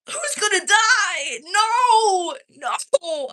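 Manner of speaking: high, loud mock panic over the trailer; dramatic dread. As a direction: ham up the horror of losing a favourite character. Reacting to a show trailer.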